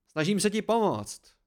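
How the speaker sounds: insist, pitched high; exasperated protest. A narrator's reading. Narrator protests he means to help, exasperated.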